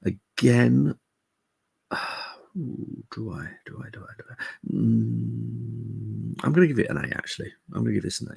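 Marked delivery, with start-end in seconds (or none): none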